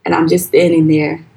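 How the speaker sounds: usual speaking voice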